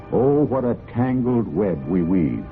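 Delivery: ordinary delivery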